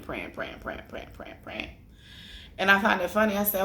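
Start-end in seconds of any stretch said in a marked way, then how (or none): 0.0-1.7 fading prayer murmur. Retold or imitated. Repeating grandma's murmured prayer.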